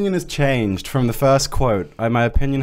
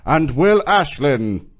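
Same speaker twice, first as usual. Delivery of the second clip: trailer announcer; 5 semitones higher, slower than usual. Pretend. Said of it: "Do more".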